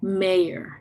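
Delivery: mayor said with the r stressed at the end; American-style mayor, r clear. Shown as a demonstration. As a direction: lean on the r in mayor. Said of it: "hace énfasis en esto".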